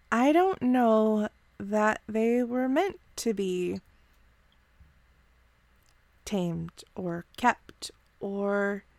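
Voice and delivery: soft, slow, thoughtful reproach; a kind elf letting him down gently; gentle Shael. Acted.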